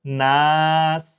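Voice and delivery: নাস very slow, ending on a held s; drawn-out নাস with no final vowel; pausal stop. Shown as a demonstration. Teacher shows the waqf reading নাস to pupils. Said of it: "আমরা পড়ব কি?".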